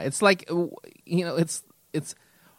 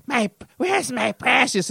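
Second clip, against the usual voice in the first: high, slow creature whine; Gollum; 9 semitones higher, slower than usual. Acted. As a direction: hunt for your treasure as a high-voiced creature. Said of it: "where he's just like".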